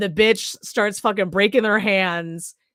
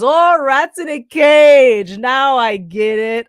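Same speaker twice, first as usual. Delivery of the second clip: loud, drawn-out mock epiphany; slow, hammed-up "now I understand"; mock dawning realisation; 2 semitones higher, 5 dB louder, slower than usual. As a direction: stretch it out like a slow penny finally dropping. Acting out a slow-witted realisation.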